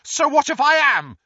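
high, loud defiant retort; loud high defiant answer; loud defiance. Acted. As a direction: throw it back at the dark lord. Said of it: "said Neville loudly".

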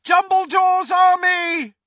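very high, loud battle cry; rally the crowd with a cry; very high loud rallying shout. Acted. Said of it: "he shouted".

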